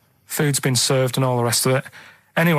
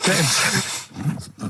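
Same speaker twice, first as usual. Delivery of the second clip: laughing; 4 semitones higher, 3 dB quieter, much slower than usual. Real, not acted. Breaks up laughing mid-story.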